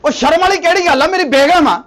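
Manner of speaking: loud, high, defiant; indignant shout. Acted. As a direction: fling it back at her. Husband defending his second wife.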